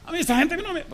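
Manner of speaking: grito furioso muy agudo; escúpelo con rabia de enfermo. Retold or imitated. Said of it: "Se puso rojo de la ira el tipo". (enfermo furioso contra su familia, a gritos)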